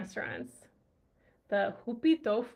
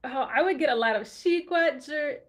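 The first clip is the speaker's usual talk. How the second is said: normal talking voice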